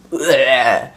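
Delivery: mock retch sound; bleh, like someone being sick; imitated vomiting noise. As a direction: spit out a comic throw-up noise. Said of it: "I felt, I heard".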